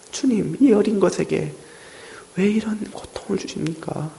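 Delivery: a high, hushed cry to God; whispered lament. Retold or imitated. A grieving mother questions God in a high whisper. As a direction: let the complaint sink to a whisper.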